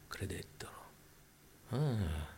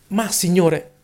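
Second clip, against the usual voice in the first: high, louder objecting cry; mock protest; 10 semitones higher, 5 dB louder, slower than usual. Imitating believers who object to the Lord, mock protest.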